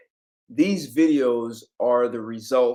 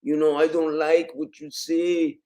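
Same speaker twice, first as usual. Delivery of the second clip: higher-pitched, put-on Latin American accent; 4 semitones higher than usual. Shown as a demonstration. Confronting a friend to show accents, Latin American accent. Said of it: "I may be Latin American or something".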